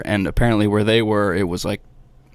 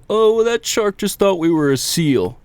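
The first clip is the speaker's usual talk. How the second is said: higher-pitched mocking imitation of cocky people; cocky humans, mocked; 9 semitones higher than usual. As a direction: put on a high voice to mimic naive self-assurance. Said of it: "we get so cocky and we say".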